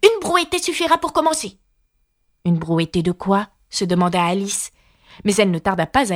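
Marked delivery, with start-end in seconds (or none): 0.0-1.6 high rabbit character voice; voix du lapin. A narrator's reading. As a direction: give the order in a high-pitched rabbit voice. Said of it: "le lapin qui disait". (voix de lapin haut perchée)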